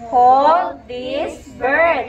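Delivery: word by word, very slow; slow model reading. Shown as a demonstration. Teacher reading a story to class, slowly word by word. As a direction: stretch each word for learners to follow.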